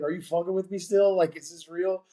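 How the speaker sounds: high, incredulous questioning; retold disbelief. Recruit asking the coach if it's real, incredulous. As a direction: pitch up high, not daring to believe it.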